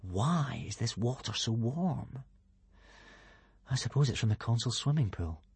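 soft, puzzled thinking aloud; musing to himself. A narrator's reading. Hiccup puzzles over the warm water, musing softly. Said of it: "frowning to himself".

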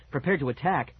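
ordinary delivery

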